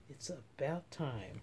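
low murmur under her breath. Acted. A mother murmuring to herself at the window. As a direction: mumble it half to yourself. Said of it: "she murmurs".

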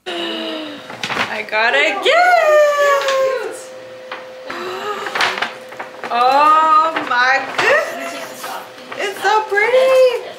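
high, loud, squealing delight over a gift; thrilled gasps. Real, not acted. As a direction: gasp and squeal with joy. Opening a gift, gasping.

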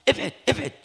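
sharp high scolding shout; angry bark. Retold or imitated. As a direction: scold the kids off the altar. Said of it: "بشخط كده وزعل". (صرخة زجر غاضبة)

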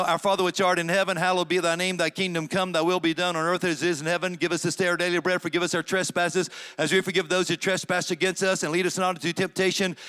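fast, routine recital of the prayer; quick rattled-off prayer. Shown as a demonstration. Preacher reciting the prayer to the congregation, quickly. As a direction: rattle it off by heart. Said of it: "Let me say it slow".

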